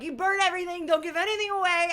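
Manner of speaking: high, loud, insistent instructions; emphatic high-pitched orders; bossy orders. Retold or imitated. Acted orders about belongings.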